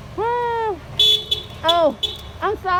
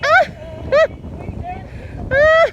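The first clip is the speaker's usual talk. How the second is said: delighted shriek; 10 semitones higher than usual. Real, not acted. Surprised by a friend.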